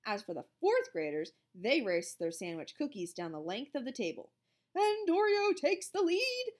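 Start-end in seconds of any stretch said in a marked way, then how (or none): 4.7-6.5 high, loud, drawn-out race call; sports announcer voice. A narrator's reading. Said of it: "in her sports announcer voice".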